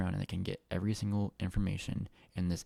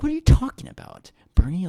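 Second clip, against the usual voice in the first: loud, high shocked outburst; 11 semitones higher, 12 dB louder than usual. Retold or imitated. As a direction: blurt it out, way up high. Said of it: "I'm like".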